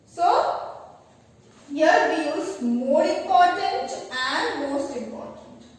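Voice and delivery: usual speaking voice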